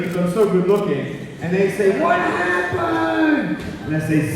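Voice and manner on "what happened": put-on high, drawn-out shocked kid voice; high-pitched, slow, incredulous question; staring kid. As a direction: stretch it out high like a stunned child. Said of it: "when kids come up to me".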